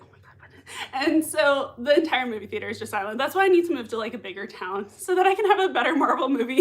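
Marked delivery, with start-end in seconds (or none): 0.0-1.0 unvoiced, laughing whisper. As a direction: hush it like in a silent cinema. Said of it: "we were like".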